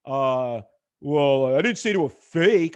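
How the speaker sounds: normal talking voice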